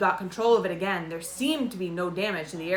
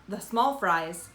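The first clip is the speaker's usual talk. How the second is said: ordinary delivery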